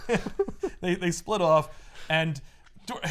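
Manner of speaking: ordinary delivery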